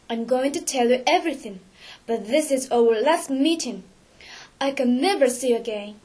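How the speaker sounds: plain reading voice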